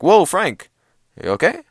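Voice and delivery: high, loud mock concern; phone talking back. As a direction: imitate an alarmed assistant, high and loud. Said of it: "It's like".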